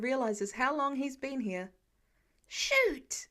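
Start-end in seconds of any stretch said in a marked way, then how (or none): 2.5-3.3 high startled yelp; exclaiming. A narrator's reading. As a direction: blurt it out, caught off guard. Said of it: "he exclaims quietly".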